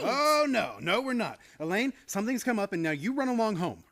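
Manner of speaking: loud nervous shooing; flustered insistence. Acted. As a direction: hustle her out the door. Pushing fiancée out.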